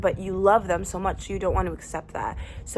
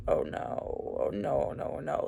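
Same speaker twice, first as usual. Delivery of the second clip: dismayed mutter; 6 semitones lower, 8 dB quieter, slower than usual. Retold or imitated. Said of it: "finding myself being like".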